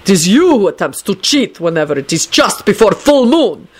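put-on indignant accented counter-accusation; outraged. A narrator's reading. Dragomir accusing Lupin of cheating, outraged. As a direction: throw the accusation back. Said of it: "Dragomir shouted".

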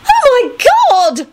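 very high, drawn-out squeal; thrilled, stretched high cry; excited yell. A narrator's reading. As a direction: shriek it with delight. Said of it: "Rosie yelled it excitedly".

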